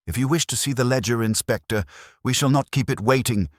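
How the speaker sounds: a brisk, proper postmistress welcoming the Inspector; lightly voiced prim postmistress. Miss Ferry greets the Inspector.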